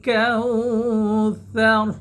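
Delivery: slow, chanted tajwid recitation; Quran recitation. Acted. Teacher recites the full surah for students to follow, chanted. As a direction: recite it slowly, melodic and held.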